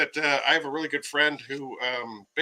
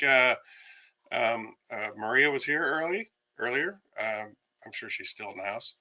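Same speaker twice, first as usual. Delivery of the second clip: usual speaking voice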